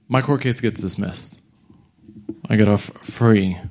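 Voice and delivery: usual speaking voice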